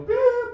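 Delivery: very high, loud mimicked beep; be the scanner with one piercing held note. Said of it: "She goes like that, I go".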